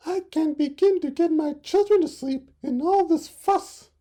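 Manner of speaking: fussed mother dove. Acted. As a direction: complain like a harried mother bird. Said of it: "she said to the frog".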